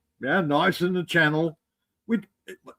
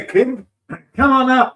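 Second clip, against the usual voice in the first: raised, higher call to join; 6 semitones higher, 6 dB louder than usual. Real, not acted. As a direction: call him up loudly. Calling Kim up to join.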